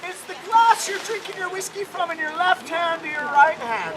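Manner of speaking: high-pitched Irish grandmother; acted gran, starting high and coaxing; Grandma Rose. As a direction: quiz him in a lilting granny voice. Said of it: "she goes".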